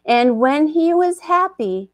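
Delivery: slow, hushed storyteller voice; epic fantasy narrator. A narrator's reading. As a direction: set the scene like an ancient legend. Storyteller sets the scene.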